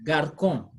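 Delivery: GARCON with a K sound mid-word, "gar-kon"; wrong hard C. Shown as a demonstration. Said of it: "supposed to be read as".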